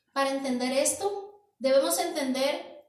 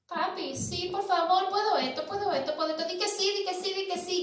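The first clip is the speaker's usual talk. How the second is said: put-on higher pleading child voice, nagging; begging child; 5 semitones higher, faster than usual. Preacher imitates a child begging his father, higher and pleading. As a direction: beg like a kid pestering his dad. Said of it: "Un niño que se te vuelve como una gotera".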